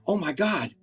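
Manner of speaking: high-pitched, elated realization; Scrooge. Acted. Re-enacting the Christmas play's moment of realization for Tom, excited. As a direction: act out a miser's joyful awakening, voice way up. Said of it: "Scrooge in the Christmas".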